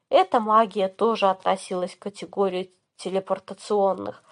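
plain reading voice

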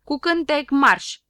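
loud call to march singing; marching command. Acted. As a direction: order the song like a sergeant. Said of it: "Șurubel comandă". (comandă tare: cu cântec, marș)

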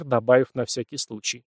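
ordinary delivery, nothing marked

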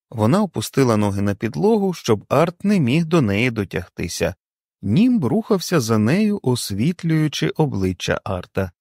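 plain reading voice

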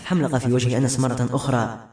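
ordinary delivery

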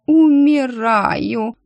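slow, drawn-out mock dying groan; hammed-up dying moan. A narrator's reading. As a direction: ham up a fake death, stretching the word. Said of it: "она простонала". (театрально простонать «Умираю!», растягивая)

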